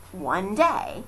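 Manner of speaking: plain reading voice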